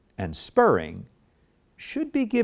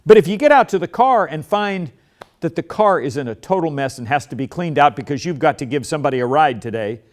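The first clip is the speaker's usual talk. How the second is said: ordinary talk, nothing marked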